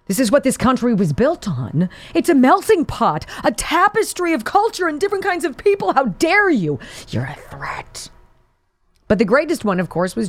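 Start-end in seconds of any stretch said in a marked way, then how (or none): 2.1-6.8 high, sing-song mock outrage; sarcastic high-pitched pearl-clutching; mock indignation. Mocking the left's rhetoric. 7.1-8.1 slow, hushed whisper; slow sarcastic whisper; mock whisper.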